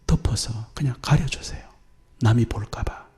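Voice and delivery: soft, voiceless and gentle; a hushed, tender reassurance; tender whisper. Real, not acted. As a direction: whisper it like a secret kindness. Tender whisper about covering.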